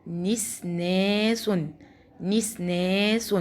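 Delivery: drawn out very slowly, syllable by syllable; slow syllable demo. Shown as a demonstration. Teacher spelling a word slowly for first graders. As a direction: stretch each syllable for the children. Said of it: "الكلمة اللي بعد كده ني".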